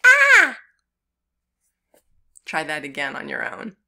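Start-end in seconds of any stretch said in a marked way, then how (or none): 0.1-0.6 high loud mock scream. Ants on arm, alarm.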